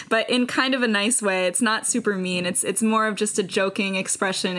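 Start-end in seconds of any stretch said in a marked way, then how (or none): none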